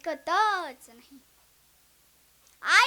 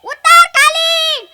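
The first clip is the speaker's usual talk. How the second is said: loud, high, very slow order; commanding shout; 10 semitones higher, 18 dB louder, slower than usual. Retold or imitated. Daughter-in-law ordering guest down, loud slow shout. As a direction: bark the order slowly. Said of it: "तीच म्हणाली".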